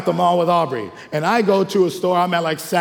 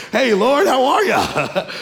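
high, slow, loud sheepish greeting with a laugh; sheepish Adam; 8 semitones higher, 4 dB louder, slower than usual. Retold or imitated. Adam in fig leaves greets the Lord, sheepish high laughing. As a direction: greet God as if nothing happened, laughing. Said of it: "I can imagine Adam and Eve".

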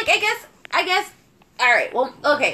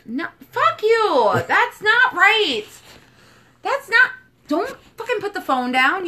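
exasperated; 8 semitones higher than usual. Real, not acted. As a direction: scoff at the wrong answer. Exasperated rejection of a wrong answer.

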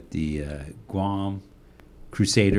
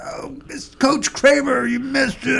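mock shouting kids; 4 dB louder than usual. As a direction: holler it like teasing kids. Kids mocking him retold.

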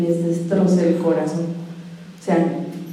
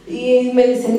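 ordinary talk, nothing marked

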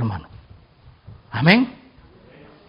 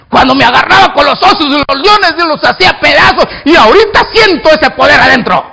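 surging, emphatic testimony; fired-up boast; 7 semitones higher, 6 dB louder than usual. Acted. Young David convincing the king he can fight, fired-up. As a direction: burst out with rising conviction.

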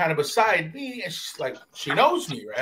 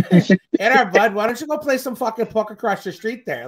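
raised, folksy cartoon Canadian; mock Canadian accent; 4 semitones higher, 5 dB louder than usual. Telling a man to go play puck, mock Canadian accent. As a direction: send him off in a joke Canadian voice. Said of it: "on some Canadian shit".

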